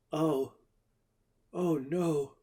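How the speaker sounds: high, hushed dread; Jerry seeing the fresh web, high and hushed; frightened whisper. Acted. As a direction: breathe it out in fear. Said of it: "Jerry whispered".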